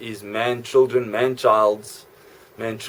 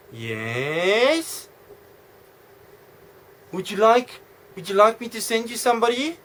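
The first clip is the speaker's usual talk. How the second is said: high, coy camp voice offering service; camp receptionist; 9 semitones higher than usual. Acted. Receptionist offering Vladimir somebody, high camp voice.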